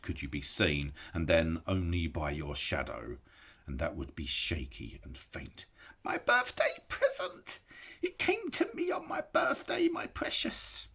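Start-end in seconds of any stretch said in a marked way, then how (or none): 6.0-10.9 high creature voice fading to a whisper; Gollum, gloating. A narrator's reading. Gollum crooning about his ring, high creature voice. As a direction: croon over the treasure.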